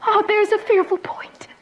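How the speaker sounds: hushed, breathless dread; a terrified whisper to herself; fearful whisper. Acted. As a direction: whisper it as the horror dawns. Juliet's terrified potion soliloquy.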